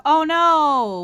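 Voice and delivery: loud, high startled cry; real alarm at the app, thrown up high; sudden loud oh no. Real, not acted. App glitch reaction.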